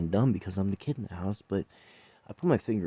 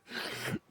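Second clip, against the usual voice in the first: laugh; 12 dB quieter than usual. Real, not acted. Laughing after retelling.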